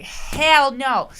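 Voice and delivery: sudden loud shout; loud refusal. Retold or imitated. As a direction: bark it out, full volume. Blunt refusal to daughter's request.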